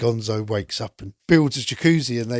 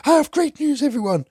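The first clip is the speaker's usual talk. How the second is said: much higher, louder, bursting with news; excited Miss Piggy; 14 semitones higher, 7 dB louder than usual. Retold or imitated. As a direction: burst in shouting the news up high. Piggy runs into the house.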